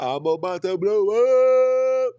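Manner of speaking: loud, high, stretched siren on "blow up"; comic wail. Pretend. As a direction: draw "blow up" out into a climbing, blaring howl.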